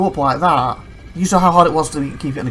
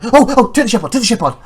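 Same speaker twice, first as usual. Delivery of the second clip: high, loud urgent blurting; acted-out flustered urgency; mock panic; 7 semitones higher, 6 dB louder, faster than usual. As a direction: blurt it out in pretend alarm. Re-enacts sudden panic in game.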